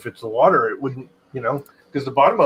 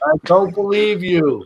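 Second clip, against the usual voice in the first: slow, higher silly voice; put-on cartoon voice; 6 semitones higher, 5 dB louder, slower than usual. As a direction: drag it out in a goofy voice. Repeats in a put-on voice.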